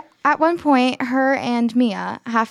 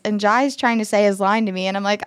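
usual speaking voice

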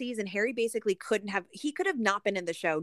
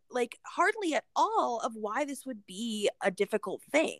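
ordinary talk, nothing marked

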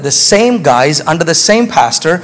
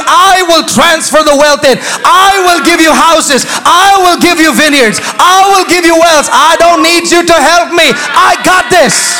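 preaching at full force; 9 semitones higher than usual. Acted. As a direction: thunder God's promise to the church. Voicing God at preaching pitch.

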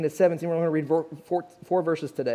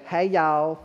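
put-on Southern accent. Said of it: "she'd lost her Southern accent".

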